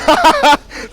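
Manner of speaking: high, loud burst of laughter; real laugh, loud. Real, not acted. Laughs after the win.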